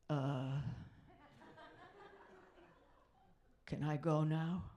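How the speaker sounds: put-on low, monosyllabic teen boy; teenage boy. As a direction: grunt it out low, wanting to leave. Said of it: "this is my impression of a teenage boy".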